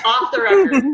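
playful high-pitched repeat; high mock echo. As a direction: squeak it back as a joke. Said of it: "echoing the joke in a high voice".

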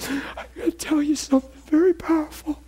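high, choked sobbing voice, acted; imitating weeping. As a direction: retell it as the friend breaking into tears. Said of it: "he's just weeping and sobbing".

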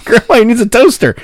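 ordinary talk, nothing marked

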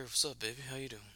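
low, soft sexy voice; a hint of a seductive crooner; forcing a deep voice. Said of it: "talk a little sexy".

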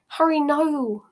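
anxious, falling wail, not too loud; moaning. A narrator's reading. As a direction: moan it as a worried plea. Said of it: "moaned Hermione".

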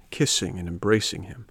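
usual speaking voice

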